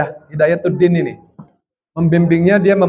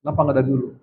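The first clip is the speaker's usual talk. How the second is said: quick quiet question; 4 semitones lower, 7 dB quieter, much faster than usual. Retold or imitated. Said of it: "ketika saya tanya".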